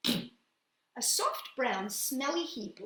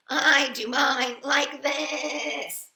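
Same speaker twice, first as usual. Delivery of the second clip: drawn-out bleating goat; slow goat voice; 5 semitones higher, 7 dB louder, much slower than usual. Acted. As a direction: stretch it like a goat. Goat answers.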